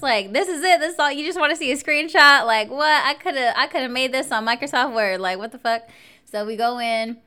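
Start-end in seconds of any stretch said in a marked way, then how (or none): 0.3-5.9 high, loud retold disbelief; incredulous. Woman baffled by the check-in screening, incredulous retelling. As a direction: burst out baffled, high, then easing down.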